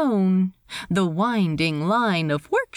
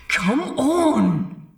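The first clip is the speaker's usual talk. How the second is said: raised, frustrated plea; pent-up frustration breaking out; exasperated; 5 semitones higher than usual. Acted. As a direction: push it out in exasperation. Ed's exasperated inner outburst.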